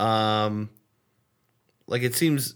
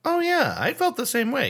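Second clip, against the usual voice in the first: emphatic agreement; 13 semitones higher than usual. Real, not acted. Emphatic agreement about childhood belief.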